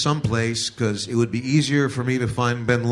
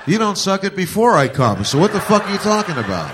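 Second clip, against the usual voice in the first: higher, louder indignant comeback; mock angry retort; 7 semitones higher, 6 dB louder than usual. Husband snapping back at his wife, hammed-up indignation. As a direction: snap back, offended.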